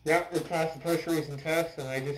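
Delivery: muffled behind mask filter; speaking inside respirator; through a gas mask. Real, not acted. Seen 3: Full gas mask covers mouth throughout; R frames show it off.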